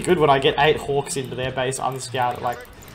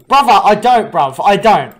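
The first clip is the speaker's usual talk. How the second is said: loud, higher British-tinged denial; loudly denying a British accent, in one; mock British protest; 7 semitones higher, 12 dB louder than usual. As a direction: insist you're not British in a British lilt. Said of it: "I never sound British".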